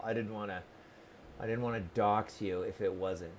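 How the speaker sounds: softly muttered; quiet aside. Real, not acted. Streamer reassuring a viewer she won't dox him, quiet. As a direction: murmur it apologetically. Apologetic aside about doxxing.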